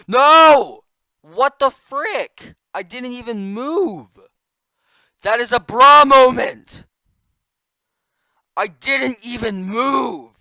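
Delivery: furious high-pitched yelling; angry shouting. Real, not acted. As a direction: rage at the game unfairly. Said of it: "I'm triggered".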